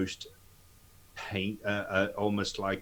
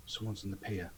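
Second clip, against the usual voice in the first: hushed, much softer warning; whispering; 8 dB quieter, faster than usual. Acted. Player voicing a character's quiet warning that someone's on the pier, whispering. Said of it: "but he's gonna whisper".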